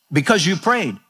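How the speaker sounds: usual speaking voice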